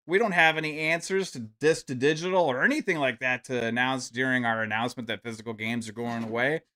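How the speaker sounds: usual speaking voice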